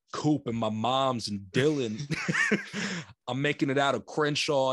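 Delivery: a loose mock American LA accent, slowed and breaking into a laugh; a light, half-laughing impression in an LA American accent; imitating an LA American accent. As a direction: play a British actor's hammed-up Crenshaw American accent. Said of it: "they have to do an American accent".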